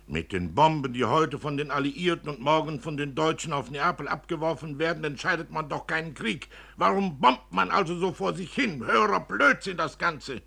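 höher und lauter, bitter spottender Vater; über den sinnlosen Krieg ärgern; bitterer Vater. Acted. Vater schimpft weiter. (verbitterter Vater, spöttisch)

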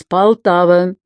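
announcer voice, cold and mechanical; metallic voice. Acted. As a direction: call out the station like a machine. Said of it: "Металлический голос произнёс".

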